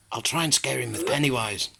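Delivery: usual speaking voice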